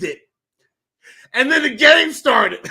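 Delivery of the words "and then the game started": loud, high, breaking into laughter; real laughter. Real, not acted. Fan admitting his belief met the game, laughing. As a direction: tell it while cracking up.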